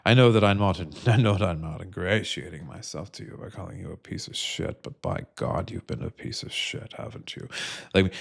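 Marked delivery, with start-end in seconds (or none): 3.8-7.5 softer, hushed insult; hiss-free whispered jab. Acted. Insulting Republican members of Congress in a whisper.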